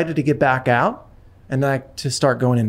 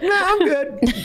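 laughing; 13 semitones higher, slower than usual. Retold or imitated. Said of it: "You're like".

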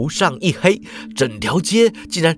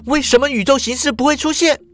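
put-on high, fast pressing girl's voice; faster, more urgent repeat; Shuhua insisting; 10 semitones higher, faster than usual. A narrator's reading. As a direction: fire the question at him. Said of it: "又问说".

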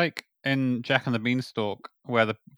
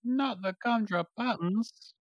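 squeaky gingerbread man; 9 semitones higher, 8 dB quieter than usual. Retold or imitated. Quoting gingerbread man lament.

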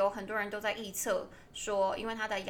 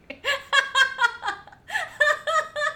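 loud laughter; 11 dB louder than usual. Real, not acted. Laughing at slow recording.

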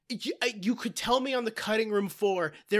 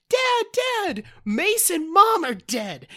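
put-on high, loud frantic little girl; mock distressed child; 8 semitones higher, 4 dB louder than usual. Cindy runs up to her dad with news, mock distress. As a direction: imitate a panicked daughter, pitched way up.

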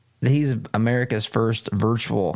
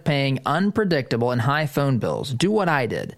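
ad read; 4 semitones higher than usual. Acted. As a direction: sell it like a friendly radio spot. Host reads sponsor ad.

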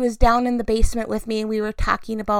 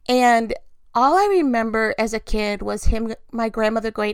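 ordinary talk, nothing marked

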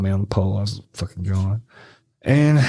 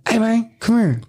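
high retold call from a car window; imitated beckoning holler; 13 semitones higher than usual. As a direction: call him over, pitch raised. Passenger calls out of window.

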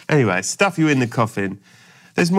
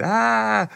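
high, hands-on-cheeks Home Alone scream; Macaulay Culkin scream; 11 semitones higher than usual. Retold or imitated. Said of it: "Everyone screams like Macaulay Culkin".

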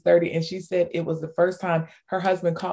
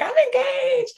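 much higher, thrilled squeal; excited announcement; slower than usual. Retold or imitated. A member telling the host her news, thrilled and high. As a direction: burst out with the happy news. Woman's message announcing engagement.

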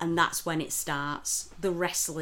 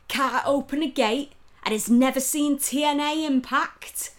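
higher, louder scornful disbelief; mock exasperation; 7 semitones higher, 7 dB louder than usual. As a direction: ham up the rude man's contempt. Imitating rude alpaca farmer.

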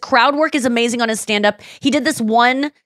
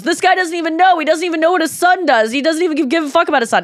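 mock outrage; 7 semitones higher, faster than usual. Retells comedian heckling a dad.

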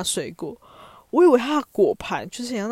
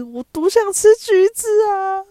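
much higher, louder, slow dramatic exclamation; exaggerated craving cry; 12 semitones higher, 10 dB louder, slower than usual. Real, not acted. Admitting to a friend how badly she wanted oranges, loud high mock wail. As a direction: wail it out like a secret longing finally bursting.

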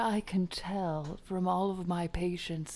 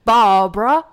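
put-on loud, high angry British bloke accent; angry British man; 3 semitones higher, 11 dB louder than usual. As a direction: shout it in a cranky British accent. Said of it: "you doing an Australian accent?".

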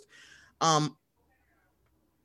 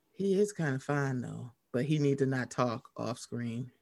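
lower, softer muttered aside; quiet aside; 7 semitones lower, 7 dB quieter than usual. Retold or imitated. Judging Henry Cavill, low soft aside. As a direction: drop it low like a private aside. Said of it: "I was like".

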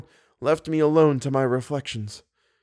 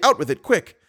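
loud, higher, urgent; fierce demand; 5 semitones higher, 4 dB louder than usual. A narrator's reading. Raoul urges Christine on, fierce and loud. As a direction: snap at her to hurry. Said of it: "exclaimed Raoul fiercely".

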